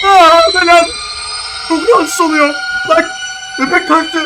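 high, very loud squeal of alarm; sudden shrill outburst; startled shriek. Real, not acted.

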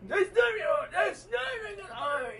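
high-pitched loud no; high loud rejection. Pretend. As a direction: shout the wrong answer down. Host rejects the answer.

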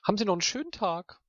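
high, fast sugary goodbye; overly polite, insincere; fake sweet farewell.

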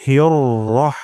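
হিয়র রহ with a short, heavy রহ; correct recitation. Shown as a demonstration. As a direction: clip রহ short, no long আ. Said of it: "শব্দটি আমরা ভালোভাবে শুনি". (সঠিক উচ্চারণ রহ)

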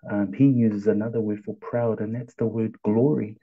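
ordinary talk

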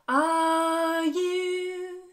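slow teasing countdown voice; suspenseful tease. Acted. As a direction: stretch it out to tease the children. Building suspense for kids.

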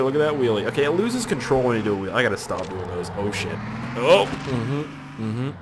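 ordinary talk, nothing marked

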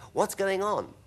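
high, startled question; boy's bewildered exclamation; alarmed cry. A narrator's reading. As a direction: cry out in confusion. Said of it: "cried James".

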